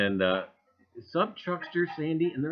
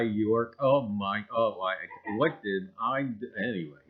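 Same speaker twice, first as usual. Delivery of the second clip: ordinary delivery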